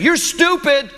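high, slow mock kid taunt, drawn out; imitating a yelling child. Kid in the store insulting his mother, high mock child taunt. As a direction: play a bratty little boy sneering at mom. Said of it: "And he kept saying".

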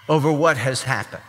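ordinary delivery

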